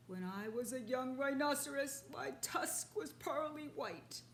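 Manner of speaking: a vain beast grieving its tusk; mournful rhinoceros. Acted. Rhinoceros lamenting to the father, mournful.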